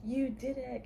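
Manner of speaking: high, loud cheer for a dog; excited praise. Real, not acted. Owner to dog after ear trick, excited praise. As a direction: cheer the pet on.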